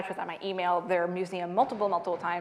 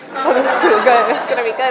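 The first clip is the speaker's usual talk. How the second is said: louder, laughing glee; savoring the show about to happen; gleeful boyfriend; 3 semitones higher, 11 dB louder than usual. Retold or imitated. Boyfriend delighting in the scene.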